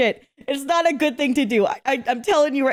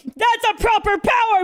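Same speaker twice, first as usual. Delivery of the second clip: excited cheer; 9 semitones higher than usual. Real, not acted. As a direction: call it out happily, still building. Cheering player's great play.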